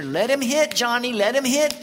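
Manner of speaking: loud, high ballfield holler; imitated shout. Granny shortstop to her pitcher, imitated shout. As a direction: holler it toward the mound like a feisty granny. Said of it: "she'd shout to the pitcher".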